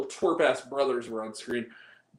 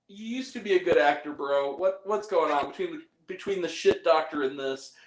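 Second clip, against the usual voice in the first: usual speaking voice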